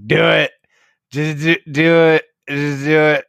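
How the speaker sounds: mock urging. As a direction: egg her on like a drunk friend. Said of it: "just be like".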